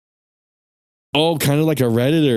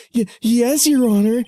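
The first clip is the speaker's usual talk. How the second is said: much higher, flustered answer; nervous young lawyer; 12 semitones higher, slower than usual. Acted. Lawyer answering the judge, much higher and flustered. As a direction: stammer it like a startled rookie.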